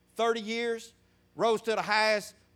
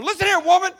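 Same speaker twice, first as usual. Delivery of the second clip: loud, high, commanding yell; bossy shout; 11 semitones higher, 12 dB louder, faster than usual. Acted. Man on the phone to a woman, mock bossy shout. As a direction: bark it like a man bossing his woman. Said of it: "with all that bass in his".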